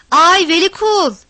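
high, loud call up the stairs; shouting upward. A narrator's reading. Havana shouting up to Velikul. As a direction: bellow for him to come down. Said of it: "bağırdı yukarıya".

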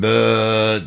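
drawn-out bovine moo; bellowing like a bull. Acted. Boy pleased to play the bull, long bellow. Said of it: "diye bağırıyordu".

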